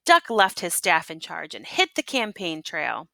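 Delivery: ordinary delivery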